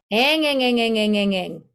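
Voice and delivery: nasal ang repeated, twangy; twang exercise. Shown as a demonstration. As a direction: sing ang ang through the nose. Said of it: "We just do".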